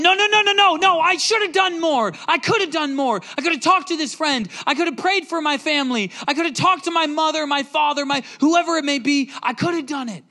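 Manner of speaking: high, loud anguished pleading; desperate regret. Pretend. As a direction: cry out in remorse at the last day. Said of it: "We're gonna be going".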